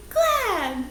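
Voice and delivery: glad sung out high and falling. Shown as a demonstration. Teacher calling the glad word high for the class. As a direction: leap up on glad. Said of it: "each one on one count".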